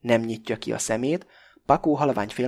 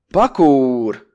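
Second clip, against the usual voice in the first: loud bellowed name; bellowing; 2 semitones higher, 11 dB louder, much slower than usual. A narrator's reading. Krestopulosz bellows at Pakó, very loud. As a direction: roar his name. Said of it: "bömböl most már Krestopulosz is".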